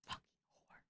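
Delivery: hushed, voiceless mock curse; mock jealous whisper. Streamer jealous over a dinner invitation, mock venomous whisper. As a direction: whisper it under your breath.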